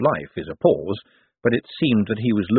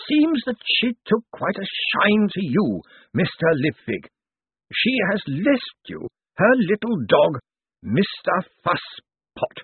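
higher, slow, dusty legal voice; dry old zombie lawyer; 10 semitones higher than usual. Acted. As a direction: ancient lawyer weighing every word. Slant reads out the bequest.

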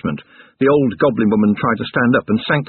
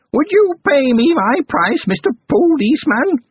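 high, slow crone voice, sly; haggling goblin crone; 3 dB louder than usual. A narrator's reading. Goblin granny naming her price to Carrot, sly high crone. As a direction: bargain like a sly old goblin.

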